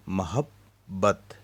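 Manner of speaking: मोहब्बत stretched slowly as mo-hab-bat with a doubled b; slow syllables. Shown as a demonstration. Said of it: "दो हिस्सों में हम divide करें". (मोहब्बत धीरे, दोहरे ब के साथ)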